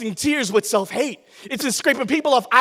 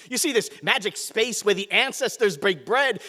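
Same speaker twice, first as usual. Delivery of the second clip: normal talking voice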